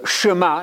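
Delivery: "Shema" said shuh-MAH; Hebrew-style pronunciation. Shown as a demonstration. Preacher names the affirmation of Jesus, "Shema" as shuh-MAH. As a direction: say "Shema" slowly, short first vowel, as shuh-MAH. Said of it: "not the Shema".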